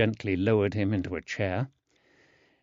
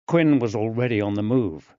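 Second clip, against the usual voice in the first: plain reading voice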